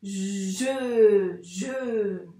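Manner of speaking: je very slow, long "zh" into held "euh"; blended syllable. Shown as a demonstration. Teacher blending letters for a beginner, je drawn out. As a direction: stretch je out as one sliding sound. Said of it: "ça fait je".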